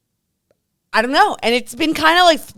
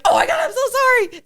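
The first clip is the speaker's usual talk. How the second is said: apologetic dad. Retold or imitated. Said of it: "And the dad was like".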